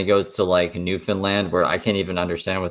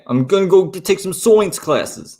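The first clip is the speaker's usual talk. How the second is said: loud, higher-pitched New Yorker impression; mock Brooklyn accent; 9 semitones higher, 5 dB louder than usual. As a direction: exaggerate a pushy Brooklyn accent. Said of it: "that's a Brooklyn accent?".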